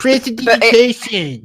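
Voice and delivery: nasal forced nerd voice. Shown as a demonstration. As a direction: say it like a nerdy know-it-all. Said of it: "say it like a nerd".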